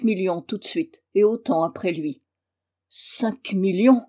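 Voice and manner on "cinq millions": dazed repetition. A narrator's reading. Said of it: "répéta trois fois le père Thomas".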